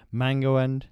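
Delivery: loud, lightly put-on Lilt advert accent. Prompting co-host to recall the new flavour, loud mock advert accent. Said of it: "Imagine I've said it in some sort of thing".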